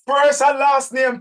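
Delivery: high, loud, slow shout; emphatic shout. Real, not acted. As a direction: bellow it so everyone remembers. Drilling the audience on names.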